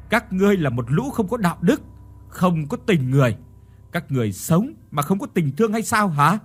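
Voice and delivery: indignant denunciation. A narrator's reading. Wife condemning in-laws as heartless, indignant. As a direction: accuse them, pushing pitch up.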